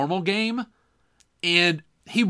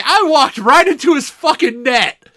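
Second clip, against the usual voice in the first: tumbling out with excited glee; 7 semitones higher than usual. Real, not acted. Excited realization, laughing.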